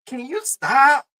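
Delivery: high, slow, drawn-out can you stop; exasperated plea. Retold or imitated. Exasperated at the co-host, high slow plea. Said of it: "You be sitting like".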